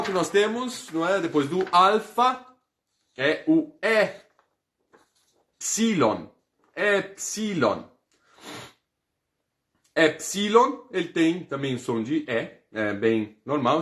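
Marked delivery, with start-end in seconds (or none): 5.6-10.9 epsilon said slowly, stress on the first syllable; slow, careful epsilon; pronunciation demo. Shown as a demonstration. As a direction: model epsilon for the class to repeat. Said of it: "está aqui escrito epsilon".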